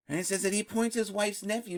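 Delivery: normal talking voice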